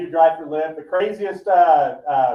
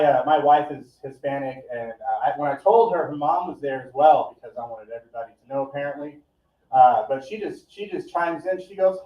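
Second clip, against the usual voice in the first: usual speaking voice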